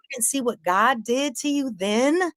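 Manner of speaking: high, mocking taunt. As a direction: tease him from way up high. Taunting Corey in the street.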